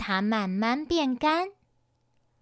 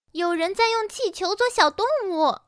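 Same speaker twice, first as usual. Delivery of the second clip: high, slightly raised announcement; deliberately loud; 7 semitones higher, 3 dB louder than usual. A narrator's reading. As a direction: say it pointedly for someone to overhear. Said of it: "云宝故意大声说". (故意提高嗓门说)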